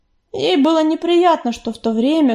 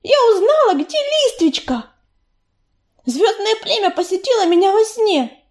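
put-on higher, urgent, breathless-sounding girl cat; a lightly voiced excited young warrior; eager young she-cat; 7 semitones higher than usual. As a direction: rush the news out, pleading. Белочка's urgent report.

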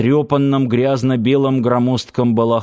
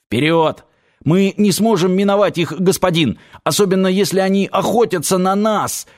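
rally him with a raised-pitch, contained shout; urgent cry; 6 semitones higher than usual. A narrator's reading. Guide urges his master into battle, crying out. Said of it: "крикнул Оторок вместо ответа".

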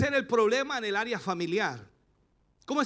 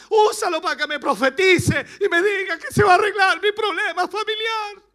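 high, loud imitation of a begging believer; imitated plea for a prophecy; mock pleading prayer; 8 semitones higher, 6 dB louder than usual. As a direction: pray like a desperate church member.

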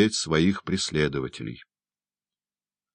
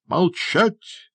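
high, sharp command for silence; stern shout. A narrator's reading. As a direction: cut them off sternly. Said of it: "строго прикрикнул мистер Бамбл". (строгий окрик)

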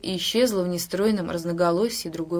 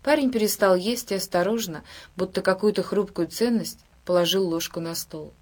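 ordinary delivery, nothing marked

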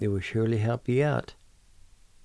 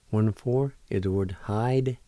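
ordinary talk, nothing marked

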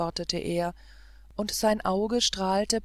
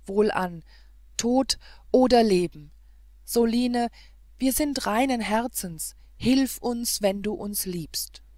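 loud, raised heroic appeal; desperate resolve; 3 semitones higher, 6 dB louder than usual. Acted. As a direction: cry out to your protectress for help. Desperate plea before leaping into sea.

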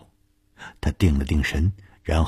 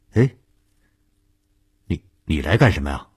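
taken aback, stammered start, quick finish; startled question; faster than usual. A narrator's reading. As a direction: blurt it out in mild astonishment. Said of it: "季医生吃惊地问". (吃惊地发问，略带结巴)

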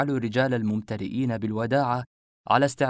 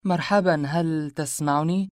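higher, drawn-out questioning call; puzzled surprise; 6 semitones higher, 3 dB louder than usual. A narrator's reading. Woman to the man lost in silence, surprised higher call. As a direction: stretch it out to get his attention. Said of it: "سألتني في دهشة".

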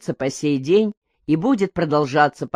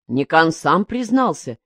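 usual speaking voice

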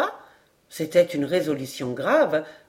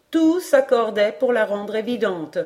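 usual speaking voice

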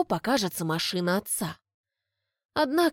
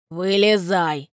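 stern father; 2 semitones lower, 5 dB louder, slower than usual. A narrator's reading. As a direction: order her out of the car. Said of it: "говорит отец".

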